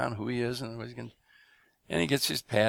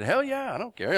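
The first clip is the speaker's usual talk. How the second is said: careless partner; 11 semitones higher, 3 dB louder than usual. Retold or imitated. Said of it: "I think he said".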